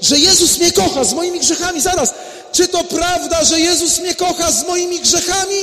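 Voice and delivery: high, loud excited shouting. Pretend. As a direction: shout it out in wonder. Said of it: "ty mówisz".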